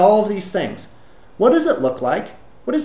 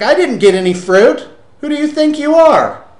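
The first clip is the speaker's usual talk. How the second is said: loud, high-pitched outraged complainer; hammed-up resentful outburst; mock indignation; 10 semitones higher, 6 dB louder than usual. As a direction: act out an affronted accuser. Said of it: "then he calls me a bastard".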